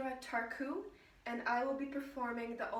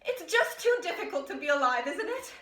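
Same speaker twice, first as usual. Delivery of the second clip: louder, agitated complaint; distressed outburst; 5 semitones higher, 6 dB louder than usual. Acted. Woman lamenting how hard it is to function, agitated outburst. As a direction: burst out as if overwhelmed.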